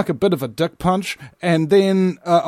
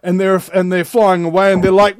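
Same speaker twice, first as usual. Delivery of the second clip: ordinary talk, nothing marked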